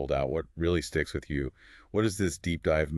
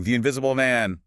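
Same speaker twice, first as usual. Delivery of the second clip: raised, higher cry of alarm; imitated shout; 7 semitones higher, 6 dB louder than usual. Kemp raising the alarm about the Invisible Man, imitated shout. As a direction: call it out like a mock alarm shout.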